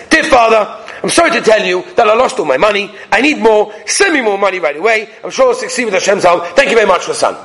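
brisk, demanding letter reading; brusque, matter-of-fact delivery, no pleading; bad-mood reading. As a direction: read it out as an impatient demand. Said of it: "read in a totally different manner".

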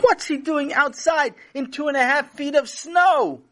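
high, astonished outcry; alarmed. A narrator's reading. Mother spotting Sammy out in snow, alarmed. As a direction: cry out in shocked alarm.

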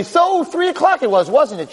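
high, drawn-out courtroom challenge; pointed cross-examination. Acted. Lawyer cornering the lady witness, pointed and high.

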